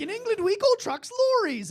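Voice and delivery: very high, loud desperate outburst; mock panicked blurt. Teen out of facts about England, loud panicked blurt. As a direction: blurt it like your bluff has collapsed. Bluffer runs out of facts, flees.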